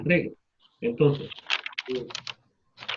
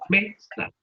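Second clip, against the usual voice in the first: mesa as MEsa, me high and strong; stress on first syllable; 6 semitones higher than usual. Shown as a demonstration. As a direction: punch the me of mesa. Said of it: "le ponemos mayor intensidad".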